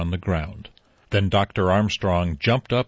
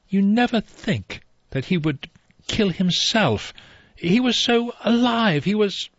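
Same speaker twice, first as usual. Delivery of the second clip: put-on higher young woman's voice, halting and slow in places; said slowly; 9 semitones higher than usual. Vera struggling to explain the dead man's vitality, slow and halting. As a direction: stumble for words as if still stunned. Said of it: "Vera said slowly".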